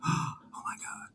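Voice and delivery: breathless whispered oh my God; awed whisper. Real, not acted. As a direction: whisper it in hushed awe. Hearing Doctor John's story.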